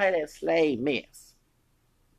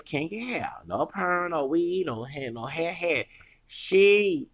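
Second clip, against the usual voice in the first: usual speaking voice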